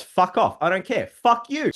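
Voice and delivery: high, jokey defiant brush-off; mock defiant. Real, not acted. Narrator snapping at imagined critics of his argument, mock defiant. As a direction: wave off the critics with mock rage. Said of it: "wasn't my strongest argument".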